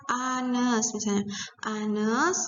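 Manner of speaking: "anes" opened on a plain "ah"; silent H. Shown as a demonstration. Teacher explaining the vowel start, "anes" with silent H. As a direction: say "anes" slowly, no H. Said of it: "Nah, ini makanya ah".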